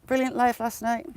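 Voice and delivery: normal talking voice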